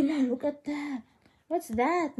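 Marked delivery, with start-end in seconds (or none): none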